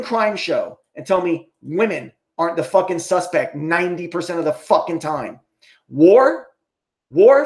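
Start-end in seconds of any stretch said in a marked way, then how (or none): 5.9-7.4 louder, higher, mock-disbelieving war; incredulous. Real, not acted. Vlogger mocking the claim that men cause war, incredulous. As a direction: repeat it like it's absurd.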